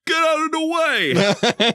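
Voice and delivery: high, slow yelled warning; yelled extra. Retold or imitated. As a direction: bellow it like a background extra. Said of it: "someone eighty yard".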